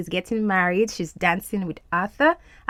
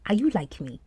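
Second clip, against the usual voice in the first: usual speaking voice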